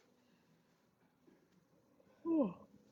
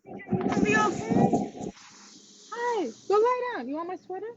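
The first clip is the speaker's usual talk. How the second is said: pet talk; 11 semitones higher than usual. Real, not acted. Talking tenderly to her dog.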